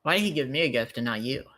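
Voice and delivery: ordinary delivery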